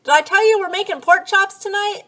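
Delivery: high, loud call to another room. Retold or imitated. Wife calling from kitchen to living room, high loud call. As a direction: yell it across the house. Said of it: "yell out to the living room".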